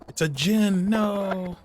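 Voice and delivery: glitchy warbling call audio; wobbly warbling call audio. Real, not acted. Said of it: "Sorry, my audio went, went wonky".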